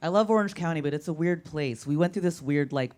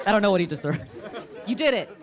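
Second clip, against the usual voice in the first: ordinary talk, nothing marked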